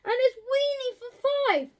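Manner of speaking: high, loud, near tears; wailing in despair. A narrator's reading. Old lady wails to the wise man, high and tearful. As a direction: wail it like she's at the end of her rope. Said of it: "The little old lady cried".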